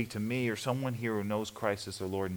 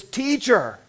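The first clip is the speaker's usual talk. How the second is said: high, loud cry of recognition; crying out Teacher; 14 semitones higher, 8 dB louder than usual. Retold or imitated. Mary at the tomb crying out to Jesus, loud and high. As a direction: call out Teacher in sudden joyful recognition. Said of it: "and she says".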